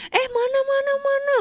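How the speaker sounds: high, sustained flustered exclamation; retold alarm. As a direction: imitate someone urgently asking to see. Quotes alarmed staff about torn seam.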